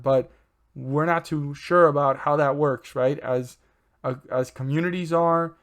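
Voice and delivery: usual speaking voice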